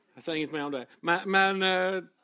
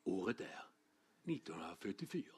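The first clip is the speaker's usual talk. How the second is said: deep hushed documentary narrator whispering the year; slideshow narrator voice; 11 semitones lower, 17 dB quieter, faster than usual. Retold or imitated. Preacher mimicking an old school slideshow narrator, deep and hushed. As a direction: drop low and hush like an old film-strip voice. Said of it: "det en röst till också".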